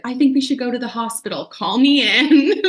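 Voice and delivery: playing the panicky partner asking to call in; a worried dad's voice, lightly mocked; anxious dad. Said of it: "maybe dad is like".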